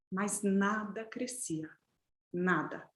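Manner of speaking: low, slow, quiet; hushed and slow. A narrator's reading. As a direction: let it sink, hushed. Storyteller drops voice for emphasis. (baixo e lento)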